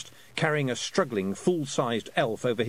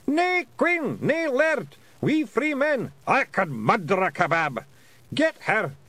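much higher, loud Scottish pixie voice; Feegle battle cry; 12 semitones higher, 5 dB louder, slower than usual. A narrator's reading. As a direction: yell like a wee angry clansman. Feegles shouting battle cries.

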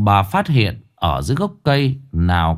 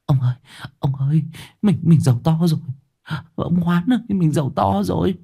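trembling excitement; 6 semitones higher, faster than usual. Acted. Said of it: "ôm chầm lấy ông run rẩy".

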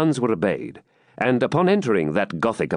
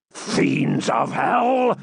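high, slow, outraged oath; furious curse; much slower than usual. Acted. Baron realising the prisoner escaped, furious oath. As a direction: spit out the curse in fury. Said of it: "echoed Front de Bœuf".

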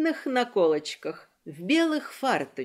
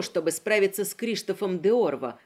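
plain reading voice

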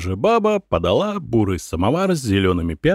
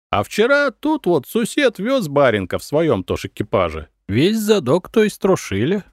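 put-on wary old peasant; 5 semitones higher than usual. A narrator's reading. Old man warning travellers of robbers on the road, raised peasant voice. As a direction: tell of the troubles in an old peasant's singsong.

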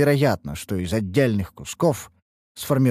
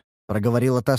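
ordinary delivery

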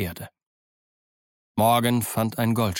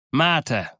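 calling out; 4 semitones higher than usual. Acted. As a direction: hail the crew before the charge. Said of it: "rief er".

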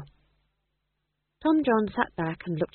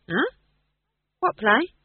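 higher, mock-innocent query; 8 semitones higher, 3 dB louder, slower than usual. A narrator's reading. Playwright dodging boss's question about the play, innocent. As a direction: play dumb, pitch lifting. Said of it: "said Howell innocently".

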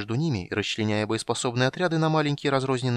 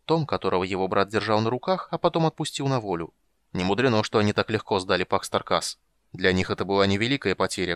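plain reading voice

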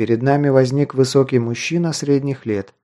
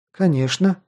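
higher-pitched note of surprise; understated amazement, pitched up; mildly astonished; 6 semitones higher than usual. A narrator's reading. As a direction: answer as if taken aback. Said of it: "изумилась девушка".

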